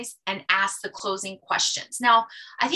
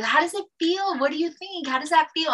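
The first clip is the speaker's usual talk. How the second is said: much higher, lively and encouraging; bubbly consultant questions; 6 semitones higher than usual. Shown as a demonstration. Bright higher encouraging questions. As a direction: consultant invites the client to feel her skin. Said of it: "you could just do this".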